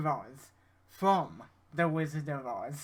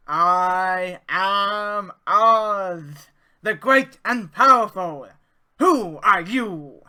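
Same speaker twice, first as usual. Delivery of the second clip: booming, drawn-out, higher-pitched wizard voice; 4 semitones higher, 13 dB louder, much slower than usual. Shown as a demonstration. Tutor impersonates the wizard for a recording demo, grand booming voice. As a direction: proclaim it very loudly and slowly, stretching the words. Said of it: "an impression of my own here".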